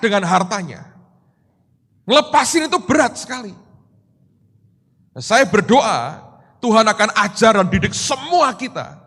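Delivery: loud, high, emphatic sermon delivery; forceful preaching. Real, not acted. Preacher exhorting congregation about attachment to wealth, loud and impassioned. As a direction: drive each phrase home to the crowd.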